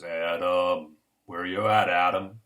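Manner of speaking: loud, low booming call of God; God summoning Adam, low and loud. Acted. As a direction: call across the garden like a looming God. God calling for hiding Adam.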